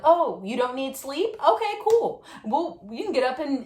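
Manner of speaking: mock-surprised sarcasm; sarcastic instructor.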